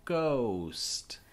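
GHOST drawn out slowly, long O sound; slowed down. Shown as a demonstration. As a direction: stretch the vowel in GHOST for learners. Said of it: "I'll slow it down".

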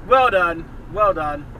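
loud mocking well done, repeated; slow-clap praise, dripping sarcasm; loud repeated sarcastic congratulations. Real, not acted. Sarcastic praise to bad driver.

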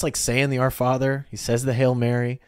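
ordinary delivery

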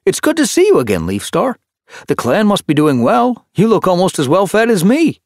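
high, loud, chirpy tom; cheerful greeting. A narrator's reading. Kittypet greets his old clan leader, cheerful. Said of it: "Hutch declared quite cheerfully".

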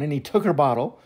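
usual speaking voice

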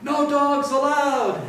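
high, slow storybook yell. A narrator's reading. Grumpy cactus warning off the coyote, yelling. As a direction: yell each word out as a warning. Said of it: "Hank yelled".

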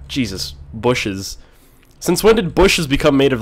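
ordinary delivery

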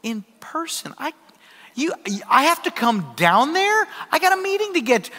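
incredulous, pitch climbing in protest; mock disbelief building to a high protest; getting heated. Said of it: "I start to get a little heated".

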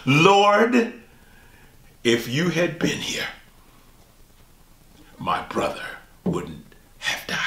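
plea fading to a broken whisper; Mary grieving, voice dropping to a tearful whisper. Acted. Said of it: "says the same words as her sister Martha".

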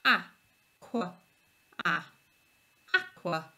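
aqua split into a and qua; teacher modelling a word; sounding out. Shown as a demonstration. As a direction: break aqua apart, then rejoin it. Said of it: "Can you find the digraph".

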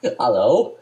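loud, badly faked Scottish accent with a rising end; mock Scottish accent. Teased by a friend, loud mock Scottish accent. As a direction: belt out a dubious Scottish greeting.

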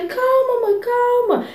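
high, drawn-out "calma"; sing-out high plea for calm; retold calming plea. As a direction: imitate trying to settle a panicking mom. Soothing her panicking mother, retold.

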